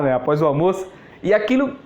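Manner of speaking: ordinary talk, nothing marked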